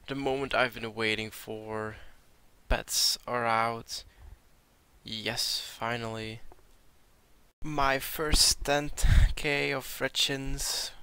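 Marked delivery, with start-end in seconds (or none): none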